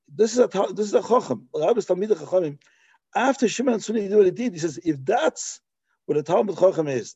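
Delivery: normal talking voice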